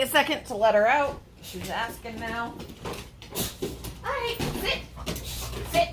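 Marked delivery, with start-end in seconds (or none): 4.0-5.9 pet voice. Real, not acted. Talking to her dog.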